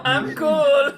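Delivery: high, loud mock French accent; hammed-up squeaky French voice; squeaky French impression. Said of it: "it's very squeaky".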